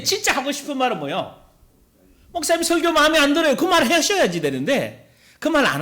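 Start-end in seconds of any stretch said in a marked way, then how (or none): 2.3-3.5 higher and fast, blurting it out; blurted complaint. Retold or imitated. Congregant finally blurting to the pastor, fast and high.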